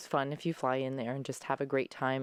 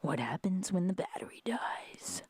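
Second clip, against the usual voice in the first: whispered, higher dad voice; dad impression; 5 semitones higher than usual. Daughter showing dad how he sounds, in a whisper. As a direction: murmur it like her father. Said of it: "this is what you sound like".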